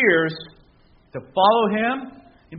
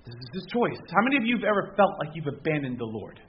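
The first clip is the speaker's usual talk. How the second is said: ordinary delivery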